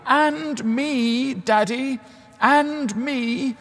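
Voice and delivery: put-on high, drawn-out child's call; child crying out. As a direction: imitate a small child calling out to be included. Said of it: "would cry out very loudly".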